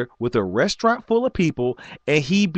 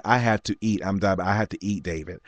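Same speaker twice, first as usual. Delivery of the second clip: softer, lower tired mother; a quietly worn-out mom; hint of his mother; 5 semitones lower, 5 dB quieter than usual. His mother tells him she must eat, soft and tired. Mother voiced in retold story.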